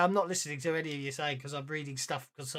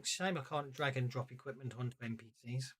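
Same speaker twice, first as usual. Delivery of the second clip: ordinary talk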